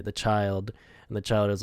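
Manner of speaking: ordinary delivery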